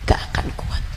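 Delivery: hushed whispered warning. Acted. The ustazah closes her warning in a whisper. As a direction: breathe it out unvoiced. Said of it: "whispered close of the warning".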